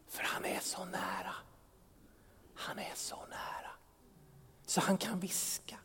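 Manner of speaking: a soft, breathy whisper. Shown as a demonstration. Pastor shows the congregation how near God whispers, whispering. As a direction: whisper as if very close. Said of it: "Gud viskar".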